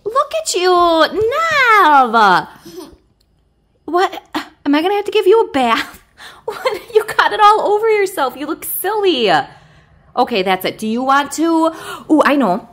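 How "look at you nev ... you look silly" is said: put-on lightly raised doting coo to a baby; a hint of sing-song baby talk. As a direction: fuss over the baby, playful. Mom coos at pretend baby.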